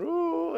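high, soft mocking "ooh"; airy high-pitched mock thrill; mock hype "ooh". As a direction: imitate empty excitement, high and light. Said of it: "it's all hype and emotions".

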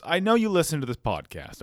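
ordinary talk

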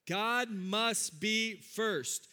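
very slow, word by word; leading congregational repetition. Shown as a demonstration. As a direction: say it slowly and deliberately for everyone to join. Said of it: "Will you say that with me?".

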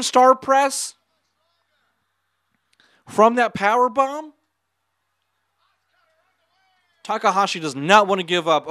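high, loud, excited play-by-play; wrestling commentator. Real, not acted. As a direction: yell the near-fall in disbelief. Excited reaction to a kick-out.

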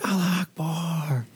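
high, drawn-out yell held down in volume; stretched-out high mock holler; mock scream. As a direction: imitate distant screaming, slowly and softly.

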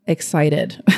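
ordinary delivery

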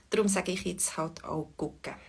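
normal talking voice

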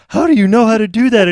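loud, high-pitched mock amazement; high, wowed imitation of a guest; amazed onlooker. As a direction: exclaim it like an impressed dinner guest. Acting amazed dinner guests' question.

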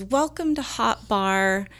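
lightly voiced instructor's slow welcome; gliding dance instructor. Dancer instructor greets his class, slow and gliding. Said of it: "glides and he says".

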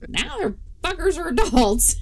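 high, loud laughing delivery; laughing through a punchline. Real, not acted. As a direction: crack up mid-line.

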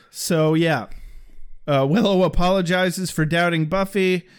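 usual speaking voice

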